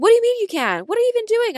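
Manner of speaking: exasperated mom. Retold or imitated. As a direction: mimic a mother scolding in disbelief. Mom barging in, retold.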